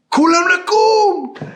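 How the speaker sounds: high, loud, slow alarm yell; scream. Retold or imitated. Comms guy screams the alarm to the sleeping team, high and loud. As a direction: drag out the alarm call at full volume. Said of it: "And so he screams".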